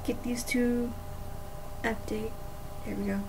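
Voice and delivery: ordinary talk, nothing marked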